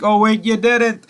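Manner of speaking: high, slow mocking jab; sarcastic jab. Tasha throwing it back at Tommy, slow high sarcasm. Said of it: "And she's like".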